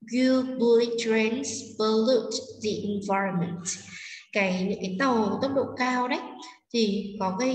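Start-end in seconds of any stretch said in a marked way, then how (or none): 0.1-3.7 very slow question with weight on "bullet", "trains", "pollute", "environment"; sentence stress. Shown as a demonstration. Teacher repeating the question for students, slow stress on "bullet", "trains", "pollute", "environment". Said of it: "Chúng ta sẽ đọc trọng âm như sau".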